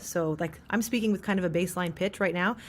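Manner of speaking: ordinary delivery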